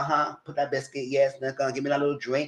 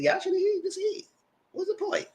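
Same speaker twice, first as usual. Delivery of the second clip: much higher, squeaky imitation of herself; mock squeaky voice; 5 dB quieter than usual. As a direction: squeak it out in a silly high voice.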